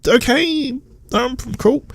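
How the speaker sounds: high, sing-song mock of a flat character; lightly hammed-up parody of an expository sidekick. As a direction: send up her clunky lines in a high voice. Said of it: "Exposition. she's barely a character".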